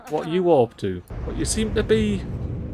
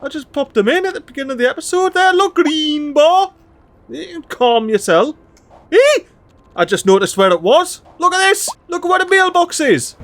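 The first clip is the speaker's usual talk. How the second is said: much higher and louder, exasperated ranting; indignant outburst; 10 semitones higher, 6 dB louder than usual. Real, not acted. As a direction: vent at the silly complaint. Indignant at mail complaint.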